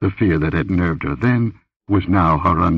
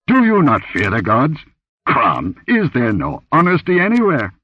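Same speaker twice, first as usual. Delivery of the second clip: indignant outburst; 8 semitones higher, 3 dB louder than usual. A narrator's reading. Conan indignantly rebukes the impostor.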